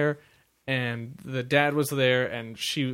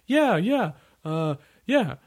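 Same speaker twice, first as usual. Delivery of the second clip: put-on high, brushing-off kid voice; a kid changing the subject; bored kid voice; 7 semitones higher, 4 dB louder than usual. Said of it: "the kid's like".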